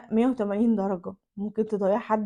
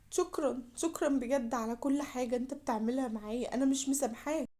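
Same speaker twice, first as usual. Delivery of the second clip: hurt reproach; 10 dB quieter than usual. Acted. الزوجة تعاتب زوجها بهدوء مجروح.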